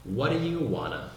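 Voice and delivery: "What do you wanna" linked as "waddayu-wanna"; casual native-style linked "What do you wanna"; words run together. Shown as a demonstration. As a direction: slur "What do you" into one quick "waddayu" before "wanna". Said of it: "todas as palavras você vai juntar".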